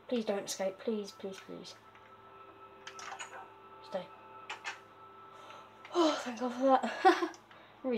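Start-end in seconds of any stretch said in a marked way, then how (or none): 0.1-4.1 hushed, pleading please after please; soft pleading. Real, not acted. Begging the villager not to run, soft and pleading. 5.9-7.0 relief breaking into a laugh; relieved laugh. Real, not acted. Relieved the villager stayed, laughing thanks. Villager finally stays put.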